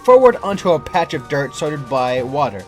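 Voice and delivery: usual speaking voice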